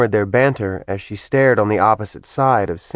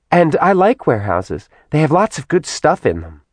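put-on high-pitched, excited girlish demon; bubbly Simi demon; 7 semitones higher than usual. A narrator's reading. Simi gushing to Kat about shopping, high and chirpy.

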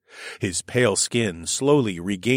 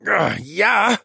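snarled; 12 semitones higher, 5 dB louder than usual. A narrator's reading. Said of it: "the cheetah snarled".

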